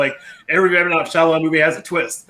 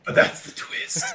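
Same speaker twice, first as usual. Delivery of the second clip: slow mock whisper; 5 dB quieter, slower than usual. Friend playing along with the joke, whispered slowly. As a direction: hush it like a reveal.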